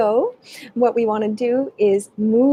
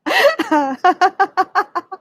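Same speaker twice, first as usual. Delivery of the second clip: high peal of laughter; 9 semitones higher, 4 dB louder than usual. Shown as a demonstration. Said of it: "laughing as you go".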